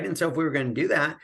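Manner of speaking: ordinary talk, nothing marked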